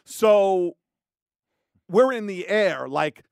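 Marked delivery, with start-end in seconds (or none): none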